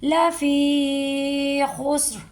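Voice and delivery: लफी खुस्र slow, with a heavy full ra; heavy ra recitation. Shown as a demonstration. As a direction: stretch लफी खुस्र and make the ra thick. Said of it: "पुर करके पढ़ी है". (लफी खुस्र में भारी रॉ)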